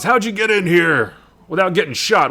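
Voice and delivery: indignant challenge to an intruder; hammed-up irritated boss; Nick Fury. Acted Fury confronting intruder.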